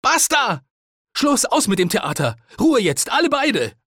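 high, furious shouting; exasperated high-pitched yelling; roaring. A narrator's reading. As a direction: shout them both down. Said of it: "brüllte ich".

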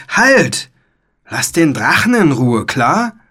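high shout settling into a firm, loud order; commanding shout. A narrator's reading. Philipp protecting the dragon, a loud firm command. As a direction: order the attacker off, starting at full pitch. Boy defends the dragon from knight.

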